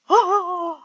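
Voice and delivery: normal talking voice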